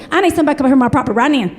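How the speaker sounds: put-on fast mountain accent, rushing the words; rushed frantic caller. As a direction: blurt it in a hurry. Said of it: "She goes".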